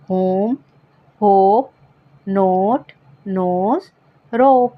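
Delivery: होम होप नोट नोज रोप each with a clear long o; long o examples. Shown as a demonstration. Teacher showing students silent-e words with a clear long o. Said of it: "अब देखिए".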